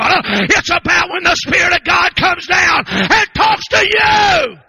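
loud, driving revival sermon; full-force preaching. Real, not acted. Preacher urging the congregation to say yes to God, full-force preaching. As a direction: preach it hard in rhythmic bursts with gasped breaths.

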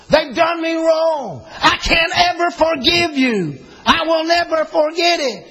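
a high, slow mock grudge; bitter grudge. Pastor voicing a hurt believer who can't forgive, mock bitter. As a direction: voice the unforgiving heart. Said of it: "there's times when we say".

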